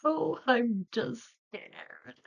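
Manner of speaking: slow, high, teary little voice; tearful. Retold or imitated. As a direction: whimper it out like a scared kid. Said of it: "And I was like".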